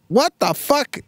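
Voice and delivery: put-on very high little-girl voice; Serafina. Daughter swearing in the back seat, little-girl voice. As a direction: pipe it up like a small child. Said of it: "she goes".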